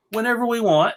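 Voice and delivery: ordinary talk, nothing marked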